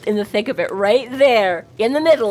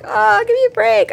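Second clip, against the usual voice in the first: exasperated; 12 semitones higher than usual. Retold or imitated. Harry's exasperated reply retold.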